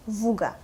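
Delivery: "вуга" as plain voo-ga, no glide; no soft sign. Shown as a demonstration. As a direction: run в straight into у in "вуга". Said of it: "If we don't have a soft sign".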